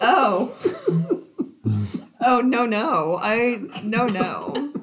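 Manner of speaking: giggling no, no, loud and high; laughing protest. Retold or imitated. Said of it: "but I was like".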